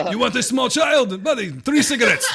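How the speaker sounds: hammed-up shady street-seller accent; put-on accent. Host joking about his ancestry, playing a shady seller in a mock accent.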